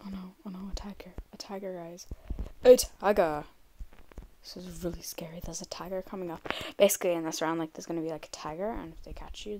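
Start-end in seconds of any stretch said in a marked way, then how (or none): 0.1-2.1 scared soft whisper; being chased. Real, not acted. Tiger coming after her. 2.6-3.4 loud scared cry; being chased. Real, not acted. Tiger appears. 4.4-6.4 frightened whisper; whisper, scared of being found; being chased. Real, not acted. Hiding from the tiger.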